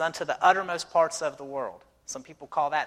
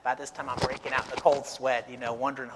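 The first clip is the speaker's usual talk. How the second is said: ordinary delivery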